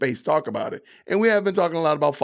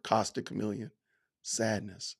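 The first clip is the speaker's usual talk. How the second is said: mock sorrow; 5 semitones lower, 10 dB quieter than usual. Mock heartbreak over a chat comment.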